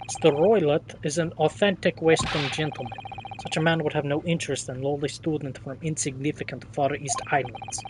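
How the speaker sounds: lightly voiced Russian-accented sailor, proud; a hint of a boastful Russian seaman. Sailor showing off the first-class cabins, light Russian accent.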